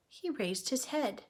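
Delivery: ordinary delivery, nothing marked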